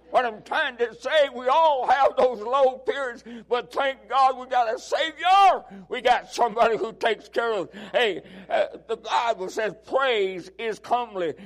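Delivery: fiery revival preaching; preaching at full force. Real, not acted. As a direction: build the congregation up.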